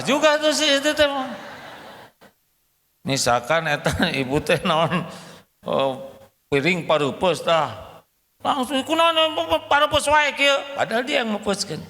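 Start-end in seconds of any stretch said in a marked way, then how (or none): 0.0-1.1 high, fast mock indignation; hammed-up higher wife's huff; mock angry wife. Wife angry though she's wrong. 8.4-11.8 high, fast hammed-up wife's complaint; mock outraged higher whine; complaining wife. Wife blames husband for plate.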